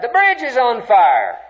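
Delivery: imitating students crying out in alarm; retold alarmed cry. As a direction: call it out like a retold scream from outside. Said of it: "we heard screams outside".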